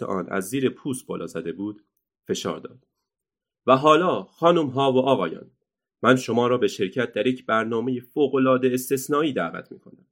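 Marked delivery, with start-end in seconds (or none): 3.7-10.1 loud showman's call to the crowd; booming circus ringmaster; ringmaster announcing. Acted. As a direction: sell it like a circus barker.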